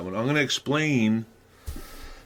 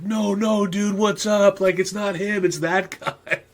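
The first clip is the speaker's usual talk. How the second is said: high, urgent crowd protest, a little louder; excited protest; 10 semitones higher, 4 dB louder, slower than usual. Retold or imitated. Neighborhood crowd telling the attacker he hit the wrong guy, high and urgent. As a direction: pitch it way up and wave them off.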